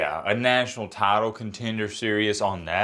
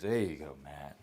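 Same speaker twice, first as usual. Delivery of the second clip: quiet aside; 9 semitones lower, 13 dB quieter than usual. Real, not acted. Quietly praising himself after redo.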